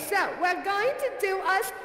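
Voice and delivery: normal talking voice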